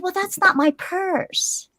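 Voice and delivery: high, drawn-out mock objection; retold protest. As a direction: act out a slow, softish denial. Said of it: "I said".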